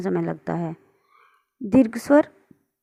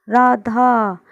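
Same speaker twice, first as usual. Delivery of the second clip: louder call to someone far away, Radha held long; 4 dB louder, much slower than usual. Shown as a demonstration. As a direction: call Radha across a distance. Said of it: "दूर है कोई व्यक्ति और उसको हम आवाज़ लगा रहे हैं". (दूर खड़े व्यक्ति को राधा कहकर लंबी पुकार)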